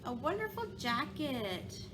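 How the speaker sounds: ordinary delivery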